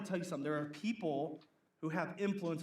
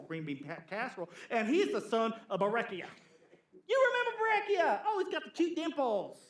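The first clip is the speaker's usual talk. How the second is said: high, chirpy gossip voice, giggling; gossipy church lady; 3 semitones higher than usual. Retold or imitated. Preacher to congregation, mimicking a sweet town gossip, high and giggly. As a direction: coo the names like juicy news.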